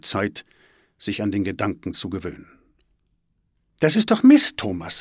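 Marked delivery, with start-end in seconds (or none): 3.8-5.0 high, loud outburst dropping on the name; angry. A narrator's reading. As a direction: snap crossly at him. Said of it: "sagt Svenja böse". (böse und aufgebracht)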